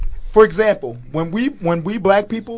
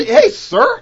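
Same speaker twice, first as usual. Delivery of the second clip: much higher, chirpy polite greeting; 6 dB louder than usual. Retold or imitated. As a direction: squeak out a customer-service hello. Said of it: "Like, if I".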